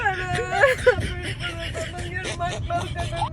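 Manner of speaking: crying. Real, not acted. Captive mother begs kidnapper for her daughters' sake, crying. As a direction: beg weakly through real tears. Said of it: "ಹಂಗ್ ಅಳ್ಬೇಡಿ ಅಕ್ಕ".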